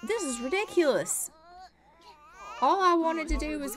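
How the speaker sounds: a touch of crying in the voice; near-tearful complaint; exasperated whine. Real, not acted. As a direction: whimper it, fed up. Upset over the ruined plan.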